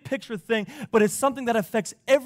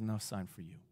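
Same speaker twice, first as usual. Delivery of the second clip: quiet refusal; 11 semitones lower, 16 dB quieter than usual. Retold or imitated. Jesus refusing the Pharisees.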